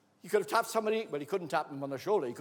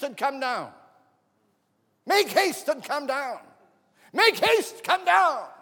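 loud, high, building call, the last much higher; Jesus shouting up into the tree, building to a loud high cry; urgent calling out; 9 semitones higher, 11 dB louder, slower than usual. Acted. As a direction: summon him down the tree, more urgent each time. Jesus calls Zacchaeus down the tree.

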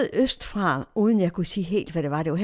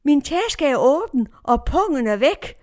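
loud, higher cry of discovery; retold alarmed shout; 7 semitones higher, 6 dB louder than usual. Woman finds her bag open, retold alarmed shout. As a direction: call out the alarm to a neighbour. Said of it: "og så råber jeg".

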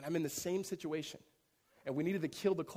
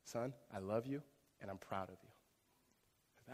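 soft, slow father's blessing, lower and softer; tender father's words; 3 semitones lower, 5 dB quieter, slower than usual. Retold or imitated. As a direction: say it softly, like a dad holding his son close. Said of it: "he says".

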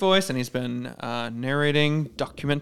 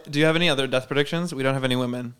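ordinary delivery

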